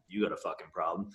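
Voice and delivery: low, soft inner voice; quiet voice in his own head. Retold or imitated. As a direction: mutter it like a thought. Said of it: "That's how my mind was telling me".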